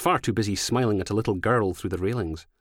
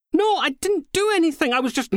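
shrill, much higher, loud yelp; panicked protest; 5 dB louder than usual. Acted. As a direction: protest in a desperate squeal. Suspect protests arrest.

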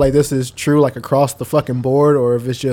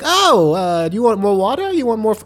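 eagerly nice server; 7 semitones higher than usual. Acted. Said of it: "they are nice as fuck".

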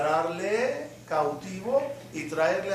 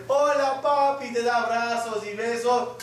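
put-on cheerful child; 3 semitones higher than usual. Rabbi voicing a son greeting his father, bright child voice. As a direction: sing out hello like a happy kid. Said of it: "el hijo que siempre viene".

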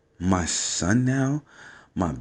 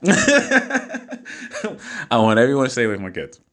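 laughing protectiveness. Real, not acted. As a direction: laugh first, then a slow warning. Laughing at his own protective rant.

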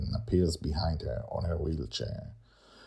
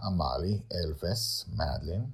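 usual speaking voice

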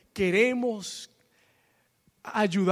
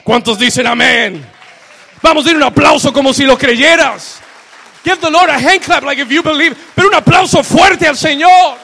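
gritando cada vez más fuerte; predicación a todo pulmón; 8 semitones higher, 16 dB louder than usual. Acted. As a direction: lleva a la iglesia al clímax gritando. Preaching climax, calls applause. (pastor pidiendo un aplauso a gritos)

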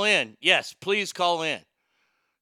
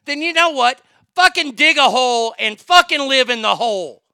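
loud, raised furious rant; angry rant; 6 semitones higher, 6 dB louder than usual. Real, not acted. Streamer rants furiously at offended viewers. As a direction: blow up at the audience. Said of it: "hateful fucking rant".

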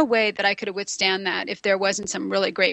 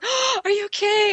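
startled concern; 11 semitones higher than usual. Retold or imitated. Joe after she falls hard.

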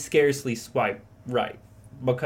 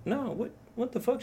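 mock bewildered; 10 semitones higher, 6 dB quieter than usual. Retold confused reply to accusation.

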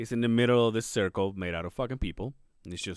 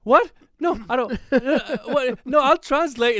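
much higher and louder, frantic denial; panicked protest; 10 semitones higher, 7 dB louder, slower than usual. Retold or imitated. Inner panic at the crew's stares, much higher and louder. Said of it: "in my head, I'm like".

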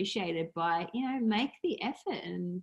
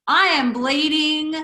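slow, deadpan declaration of a fact nobody says out loud; a hammed-up solemn statement; mock announcement; 9 semitones higher, 8 dB louder than usual. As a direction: announce it slowly like breaking news.

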